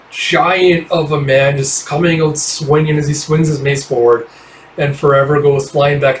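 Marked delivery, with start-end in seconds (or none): none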